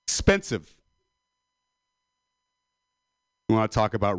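mock rant. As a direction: whip yourself into a mock fury about prices. Comedic rant building to anger.

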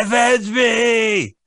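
slow, very high, drawn-out shout; overacted death scene. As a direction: ham up the dying plea. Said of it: "He's like".

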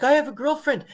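high mock protest from a flustered man; resisting man. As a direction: play him squeaky and cornered. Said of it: "He's like".

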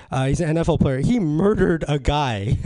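ordinary talk

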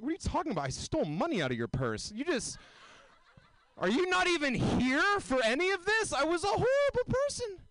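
high, loud, laughing disbelief rising to near shout; hammed-up outrage at the ex; incredulous retort. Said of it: "I'm like".

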